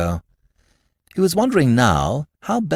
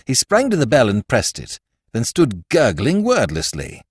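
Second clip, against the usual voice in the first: ordinary delivery, nothing marked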